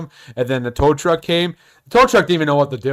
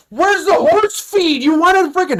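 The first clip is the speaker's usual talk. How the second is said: incredulous yell; 12 semitones higher, 3 dB louder, slower than usual. Retold or imitated. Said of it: "I'm like".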